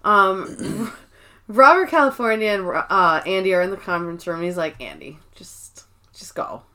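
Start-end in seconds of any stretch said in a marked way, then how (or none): 4.8-6.6 soft unvoiced whisper. Robert California telling Andy to leave, whispered. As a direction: breathe it out, barely voiced.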